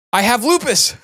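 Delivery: high, triumphant announcement; excited exclamation. Acted. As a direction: blurt it out like a discovery. Patient proudly self-diagnoses.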